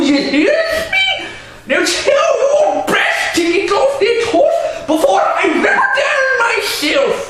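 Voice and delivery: put-on high, loud drunken ranting; ordering. Drunk owner refusing to sell and ordering her down, raging. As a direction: roar orders like a brute. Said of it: "he ordered".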